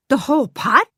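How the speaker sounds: can't believe her ears; gasped. Acted. Glenda hearing she wins the whole pot, gasping. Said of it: "Glenda gasped".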